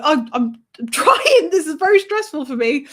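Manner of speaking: high, loud mock-stressed plea with a laugh; flustered protest. Driver answering the screaming passenger, flustered mock protest. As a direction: plead back, overwhelmed but half laughing.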